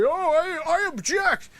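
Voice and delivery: high whiny mock objection; high-pitched mock objector. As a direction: squeak out a prissy objection. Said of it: "they're gonna say".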